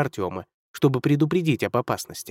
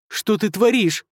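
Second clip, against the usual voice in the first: high alarmed cry; frightened cry; 8 semitones higher, slower than usual. Acted. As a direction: cry out in horror. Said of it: "вскрикнул юный гремлин".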